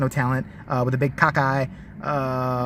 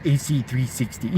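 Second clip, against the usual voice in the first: Anderson Cooper; 7 semitones lower, 5 dB quieter than usual. Acted. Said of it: "Is that some Virginia accent?".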